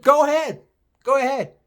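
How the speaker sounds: high-pitched, unbothered dare; retold comeback, voice lifted right up; dismissive dare. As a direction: call the customer's bluff in a high voice. Retold dare to complaining customer.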